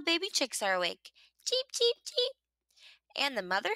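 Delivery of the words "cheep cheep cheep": high, bright little cheep cheep cheep; chick peeps. A narrator's reading. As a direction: peep high like tiny baby chicks. Baby chicks are awake.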